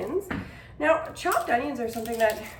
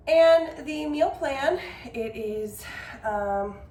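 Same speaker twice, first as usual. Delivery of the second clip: winded, out of breath and halting; 5 semitones higher, 5 dB louder, much slower than usual. Real, not acted. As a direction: talk while catching your breath. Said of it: "sorry, I'm out of breath".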